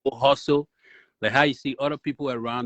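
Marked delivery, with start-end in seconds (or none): none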